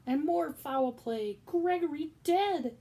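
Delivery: loud, high, slow shout. Acted. Cook shouting that Gregory is dead, loud. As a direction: cry out the news of death. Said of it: "shouted Cook".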